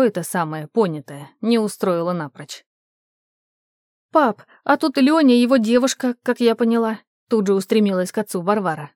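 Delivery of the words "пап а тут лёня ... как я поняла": higher, louder daughter's voice; raised, hurried-to-tell tone; eager reporting. A narrator's reading. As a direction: rush to tell your father. Daughter rushes to report to father.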